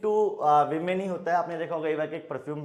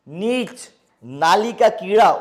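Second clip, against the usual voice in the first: mock disgust; 4 semitones higher, 10 dB louder, slower than usual. Teacher dramatizes an insulting word.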